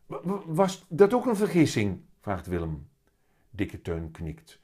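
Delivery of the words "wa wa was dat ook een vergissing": put-on high, louder child tripping over the first word; stammering little boy. A narrator's reading. Willem questions his talking cat, high stammering child voice.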